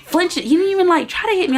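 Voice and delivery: put-on high, scornful girlfriend voice; girl taunting her boyfriend, high and mocking. Girlfriend voiced in retold fight.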